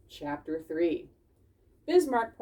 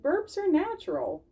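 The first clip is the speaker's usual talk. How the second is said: high, gentle fox voice; lightly teaching; Dawn's voice; 10 semitones higher than usual. Acted. Dawn the fox gently teaching the critters. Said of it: "Dawn said".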